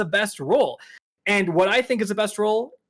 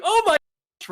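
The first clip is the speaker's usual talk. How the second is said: much higher, mock gasp of amazement; 10 semitones higher, 3 dB louder, faster than usual. Imitating fans reacting to the tweet, mock amazed. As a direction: play everyone's gushing surprise. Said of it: "everyone's like".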